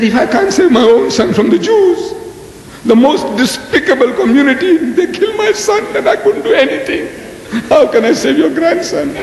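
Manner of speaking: high-pitched, hammed-up lament; helpless protest. God excusing himself over the grandson, mock-helpless. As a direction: plead helplessness, voice climbing high.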